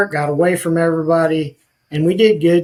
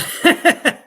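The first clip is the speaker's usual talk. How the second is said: laughing; 13 semitones higher than usual. Real, not acted. Laughs at his own fear.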